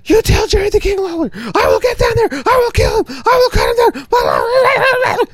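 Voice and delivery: very high, fast, shouted rant; Andy Kaufman ranting. Retold or imitated. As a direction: scream the threats in a frantic rush. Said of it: "rattles off so fast over and over again".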